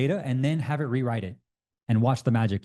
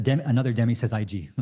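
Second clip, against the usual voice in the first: normal talking voice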